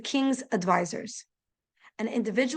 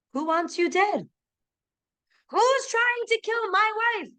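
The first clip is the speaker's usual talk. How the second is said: rising, much higher indignant outburst; outraged king; 10 semitones higher than usual. Acted. King to his wife, outraged and much higher. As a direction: demand to know who dares, voice climbing high.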